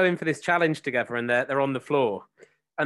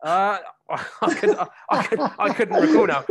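laughing; 6 semitones higher, 5 dB louder than usual. Real, not acted. Laughs at being asked about melee.

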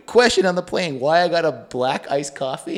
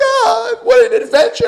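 much higher, swooning movie-hero voice; rom-com dreamer; 7 dB louder than usual. Retold or imitated. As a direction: sigh it like a rom-com lead. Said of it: "like a romantic comedy where you're like".

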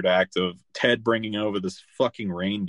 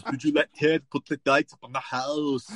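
higher-pitched comic Austrian-accent impression; Arnold; 5 semitones higher than usual. Arnold confronting his wife about Ted and the lights, mock-indignant impression. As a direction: imitate an indignant movie husband, pitched up. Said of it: "What does Arnold say?".

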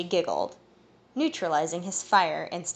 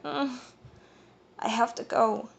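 frustrated groan; 4 semitones higher than usual. A narrator's reading. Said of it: "she groaned in frustration".

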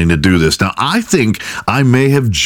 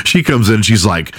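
ordinary delivery

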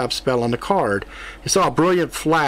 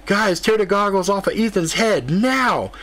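urgent call; 7 semitones higher than usual. A narrator's reading. Urgent call to tear goggles off.

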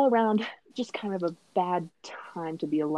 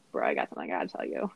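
much lower, fast dude-bro delivery; mock bro voice; 6 dB quieter, much faster than usual. Teen pulling teacher aside, mock low bro voice. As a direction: drop into a quick low bro voice.

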